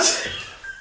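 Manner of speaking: quick unvoiced giggle; breathy laugh. Real, not acted. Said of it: "what I'm laughing for".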